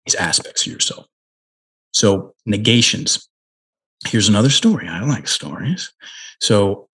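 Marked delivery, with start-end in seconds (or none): none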